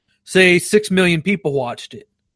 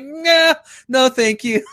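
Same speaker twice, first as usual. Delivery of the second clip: high-pitched, drawn-out turn-down; mock refusal; 8 semitones higher, slower than usual. Customer turning down a pricier cable package, high mock refusal. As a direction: wave off the sales caller in mock reluctance. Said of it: "And I'm like".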